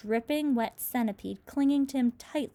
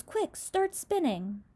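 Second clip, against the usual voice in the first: higher, slower urgent command; urgent yell; 7 semitones higher, slower than usual. Acted. James urges the silkworm to spin, urgent call. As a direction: hurry her up with a call. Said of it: "yelled James".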